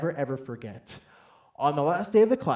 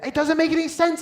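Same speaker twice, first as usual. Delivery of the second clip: very high, loud squeal; hammed-up high-pitched freak-out; frantic protest; 9 dB louder than usual. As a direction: shriek it in mock panic. Retold freak-out at wife.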